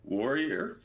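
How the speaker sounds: usual speaking voice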